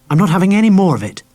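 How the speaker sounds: high, loud, sharp reproach; sharp angry woman. A narrator's reading. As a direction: snap at him like a jilted lover. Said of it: "The woman's voice was sharp and angry".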